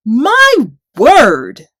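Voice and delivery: high then loud, dazed surprise; stunned giant. A narrator's reading. Goliath reacts to the hit, stunned. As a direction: reel from the blow. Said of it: "said Goliath".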